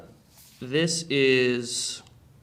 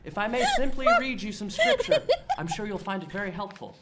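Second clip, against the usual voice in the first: high, sweet preacher voice; pastor voice; 8 semitones higher than usual. Acted. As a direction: coax like an earnest pastor. Pastor speaks in acted scene.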